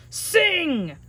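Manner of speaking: high, loud giant order; giant's command. Acted. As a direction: bark it at the harp.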